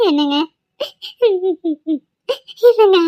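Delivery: sobbing call to her husband, pitch jumping up; tearful, gasping little cry. Acted. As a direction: call him between sobs. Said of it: "எதுக்குமா அழுவுற?".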